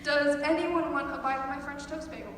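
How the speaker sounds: ordinary talk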